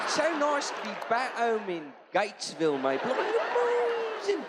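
hammed-up British accent, very high on the last words; fake British accent. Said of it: "come back with an accent".

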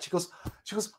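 usual speaking voice

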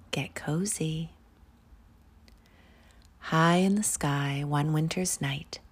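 ordinary delivery

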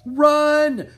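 loud high panicked shout; yell. Acted. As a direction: scream the warning as the tunnel falls. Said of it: "Drake yelled".

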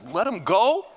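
hammed-up Pharaoh begging them to leave; Pharaoh pleading. Pharaoh urging Moses to go, loud and rising.

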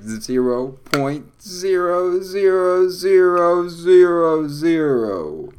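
booming, drawn-out count of zeros. Acted. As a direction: ham up each zero like an announcer. Drawing out a long string of zeros.

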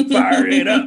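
high shouted chant; shouted hook. Retold or imitated. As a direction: belt the hook out.

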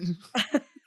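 bright burst of laughter; high laugh. Real, not acted. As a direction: laugh out loud. Laughs at co-host.

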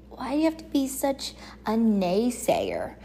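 breathy whispered complaint; whispered retort. Retold or imitated. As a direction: hush it like a mock scolding. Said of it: "I'm like".

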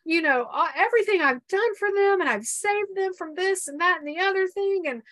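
high and loud indignant tirade; furious rant. Retold or imitated. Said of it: "I mean, I was furious".

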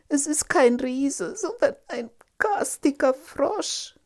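high, loud, whiny princess voice; distressed princess. Acted. Frightened princess answers her father. (aufgelöste Prinzessin, hoch und laut)

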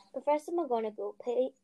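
ordinary delivery

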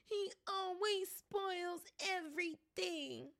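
high, slow, loud fake wail; fake crying yell. A narrator's reading. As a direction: whine like a spoiled brat. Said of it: "Dudley yelled between huge pretend snobs".

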